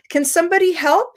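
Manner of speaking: teacher asking the class for help encouragingly; positive invitation. Shown as a demonstration. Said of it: "the way that I ask this question".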